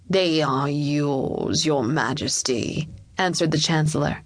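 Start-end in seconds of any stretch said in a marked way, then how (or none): 0.1-2.9 low, very slow official's voice; deferential courtier. Acted. Chancellor answering the young king, low and stately.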